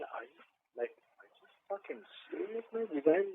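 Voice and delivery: imitating wife, high and hushed; soft, high-pitched incredulous question; blown-away disbelief. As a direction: mimic her stunned disbelief quietly. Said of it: "she was blown away".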